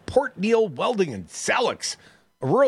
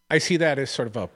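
ordinary delivery

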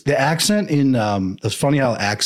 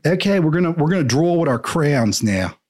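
higher, lilting Philly teacher voice; a teacher announcing crayon time in Philly accent; 6 semitones higher than usual. Retold or imitated. Remembering school, teacher's Philly accent imitated. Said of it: "being a little boy in elementary school, and they're like".

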